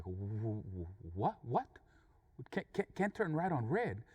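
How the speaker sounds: stammering repeat, starting low; stunned confusion. Retold or imitated. Angry driver learns why the truck waited, stunned confusion. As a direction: stumble over the words in disbelief. Taken aback by the answer.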